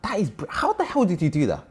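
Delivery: high-pitched astonished question; amazed. Real, not acted. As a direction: burst out in amazed disbelief. Amazed at her story.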